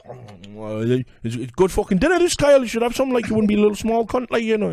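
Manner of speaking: a mock loud Gando, pleased with himself; hammed-up Gando. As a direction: brag with his mouth full of curry. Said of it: "Gando's just like".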